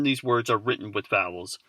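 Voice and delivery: ordinary talk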